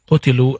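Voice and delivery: qutilu with short U then I, qu-ti-lu; contrasted Arabic word. Shown as a demonstration. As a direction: say qutilu with OO first, EE next. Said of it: "these are three different words".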